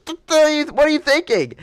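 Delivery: high-pitched retold disbelief; mock-aghast friend; alarmed scolding.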